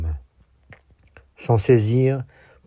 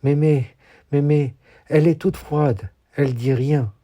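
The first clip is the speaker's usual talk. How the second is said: higher-pitched, lightly voiced alarmed child; a touch of childlike panic, raised pitch; frightened child's blurting; 6 semitones higher than usual. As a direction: blurt it out like a scared grandchild. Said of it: "pour balbutier à qui veut l'entendre".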